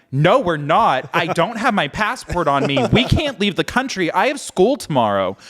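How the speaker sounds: loud, high-pitched panicked protest; freaking out. Retold or imitated. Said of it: "I'm, like, freaking out".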